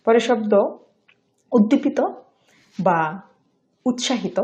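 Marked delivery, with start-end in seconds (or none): none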